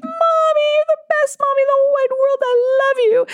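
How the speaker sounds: put-on very high, fast, gushing child's voice; adoring little kid. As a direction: play a small child adoring mom. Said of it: "they'd be like".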